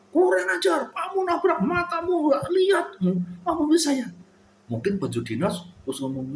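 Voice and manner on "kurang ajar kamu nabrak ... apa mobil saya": imagined angry policeman. Retold or imitated. A policeman yelling at the driver who hit him, furious high shout. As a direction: scold like an enraged cop whose car got hit. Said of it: "polisi terus itu marah-marah".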